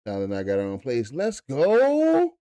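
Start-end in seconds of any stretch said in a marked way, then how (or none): 1.1-2.3 mock high "great heavens"; falsetto exclaim. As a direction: gasp it like a shocked lady.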